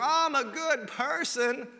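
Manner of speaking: high, drawn-out mock protest; self-satisfied do-gooder. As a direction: mimic someone proudly pleading their goodness, slowly. Voices self-satisfied believer in good deeds.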